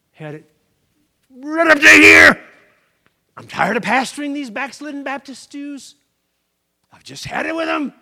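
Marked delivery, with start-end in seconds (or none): 1.3-2.4 loud slow fed-up yell; loud exasperated yell; exasperated shout. Acted. Moses fed up. 3.4-7.9 higher exasperated rant; higher fed-up outburst; fed-up Moses. Acted.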